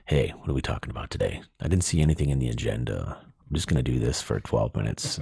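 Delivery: very low, deep mock DJ on a work call; playful deep late-night host voice; put-on radio voice. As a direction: drop the voice right down and keep it smooth. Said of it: "I'm just like".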